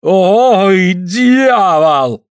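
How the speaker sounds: high, loud pained curse; cry of pain. A narrator's reading. Suль curses after the hammer hits his hand, pained cry. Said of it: "От боли Суль крутанулся".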